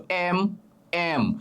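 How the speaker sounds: "এম" said very slowly, opening on the vowel e before m; slow demo of "এম" as e plus m; letter-name demo. Shown as a demonstration. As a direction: stretch "এম" so the leading vowel is heard. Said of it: "এমটা কিভাবে উচ্চারণ করছো?".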